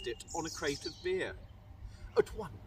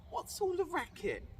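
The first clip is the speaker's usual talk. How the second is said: cried out; 9 semitones higher than usual. Acted. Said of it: "Cindy cried".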